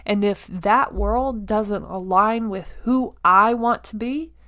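ordinary talk, nothing marked

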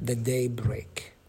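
"the daybreak" whispered softly; whispered reveal of "daybreak"; emphasised daybreak. Shown as a demonstration. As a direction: hush it to make it land. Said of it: "What's the emphasis on daybreak".

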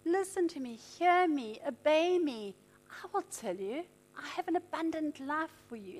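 the Holy Spirit softly calling a believer to listen; soft divine voice. Retold or imitated. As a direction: invite gently, almost whispered. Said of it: "the Holy Spirit is saying".

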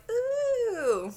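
high mocking ooh from the crowd; teasing ooh. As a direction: tease them with a long high ooh. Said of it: "everyone was like".

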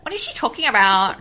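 loud mock-baffled complaint; clueless listener. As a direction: put on an indignant, confused listener. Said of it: "They'd be like".

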